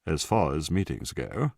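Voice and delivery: self-mocking BBC English; exaggerated BBC announcer's accent. A narrator's reading. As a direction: put on a plummy BBC accent to mock yourself. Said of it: "mocked himself in a B B C accent".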